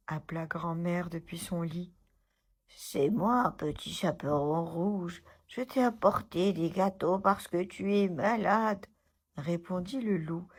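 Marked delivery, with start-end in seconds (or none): 2.7-8.9 lightly voiced wolf pretending to be the girl; wolf hiding his voice. A narrator's reading. Wolf at grandma's door faking the girl, sweet put-on voice. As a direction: disguise the gruff voice, sweet and eager. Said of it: "essayant de cacher au mieux sa grosse voix de loup".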